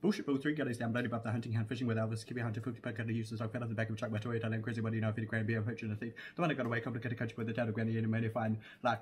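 breakneck nonstop list reading; words tumbling out in one long run; rapid-fire recital. Shown as a demonstration. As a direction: rattle it off as fast as you can. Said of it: "You wanna try another one?".

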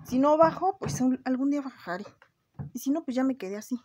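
excited high. Real, not acted. Excited upbeat declaration.

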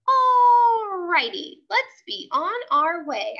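high, loud, slow stretched-out cheer; excited shout. Acted. Junie B. shouting to Mother, high excited cheer. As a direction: shout it out, thrilled to leave. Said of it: "I shouted, very excited".

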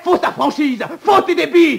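high, loud, relentless; shouted accusation. Acted. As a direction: keep shouting him down. Accusation continues over protest.

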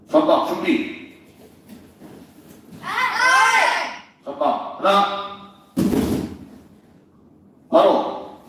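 called-out martial arts drill commands; drill sergeant. Real, not acted. Instructor calls drill commands.

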